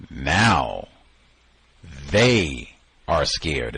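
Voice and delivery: "they" stretched long, falling away; stress on THEY. Shown as a demonstration. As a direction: pause after "Now," then a slow, weighty "they". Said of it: "they have they in, uh, italics".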